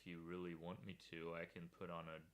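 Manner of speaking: low, soft deep voice; hushed and deliberately deep; put-on deep voice. Shown as a demonstration. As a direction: drop well down in pitch, softly. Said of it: "put on a deeper voice than this".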